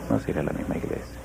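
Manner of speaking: whispered, low and very soft. Acted. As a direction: breathe the line as a whisper. Repeated answer, second whispered.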